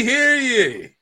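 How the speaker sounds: high falling proclamation call; town crier. Acted. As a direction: announce it like a herald. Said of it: "this beatnik shows up".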